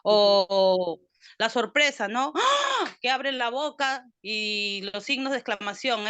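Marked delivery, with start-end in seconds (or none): 2.3-2.9 a much higher startled "ah"; surprised gasp. Shown as a demonstration. Student demonstrates surprise to the teacher with a high startled "ah". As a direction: gasp as if caught off guard. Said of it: "es sorpresa".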